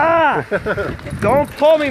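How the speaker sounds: high laughing cry; laughing yelp. Real, not acted. Owner tugged by his dog again, laughing high yelp.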